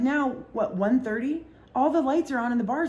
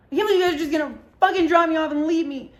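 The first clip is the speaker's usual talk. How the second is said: much higher, louder, fast mock tantrum; drunk outrage; 7 semitones higher, 6 dB louder, faster than usual. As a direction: rant like a drunk who feels abandoned. Drunk friend angry at being left.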